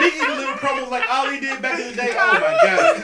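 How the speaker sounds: loud, laughing, high. Real, not acted. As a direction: get it out between big laughs. Repeats joke through laughter.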